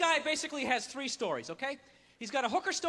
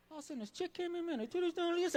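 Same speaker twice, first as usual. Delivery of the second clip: slightly hushed, secretive telling; dropped storytelling voice; 3 semitones higher, 6 dB quieter than usual. Shown as a demonstration. Storyteller reaching the good part a minute in, voice dropped. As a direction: let the voice sink to a confiding hush. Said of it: "their voice drops, like, three octaves".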